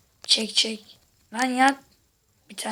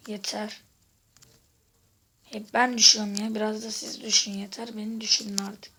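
ordinary talk, nothing marked

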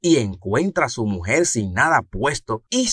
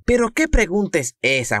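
mom voice; 10 semitones higher than usual. Acted. Mamá responde al niño.

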